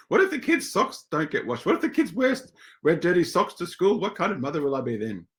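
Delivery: hammed-up anxious mother spiralling; mock panic. Mother frets about her kids wearing dirty socks. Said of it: "I had this conversation this morning".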